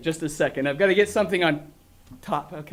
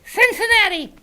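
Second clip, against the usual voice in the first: high, loud puppet dragon; chirpy little dragon saying hi; silly puppet creature; 12 semitones higher, 5 dB louder than usual. Acted. Grork's joke answer.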